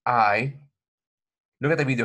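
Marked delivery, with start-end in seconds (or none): 0.1-0.5 "I" glided slowly from open to close; diphthong demo. Shown as a demonstration. Teacher modeling the diphthong, slow and clear. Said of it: "Okay, look".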